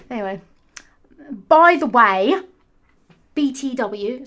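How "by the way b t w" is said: screamed, high and much louder into the mic; obnoxious voice. Shown as a demonstration. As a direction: blast it like an annoying commenter. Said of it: "really obnoxious voice".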